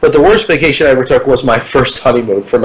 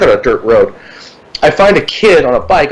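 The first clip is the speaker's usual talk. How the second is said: normal talking voice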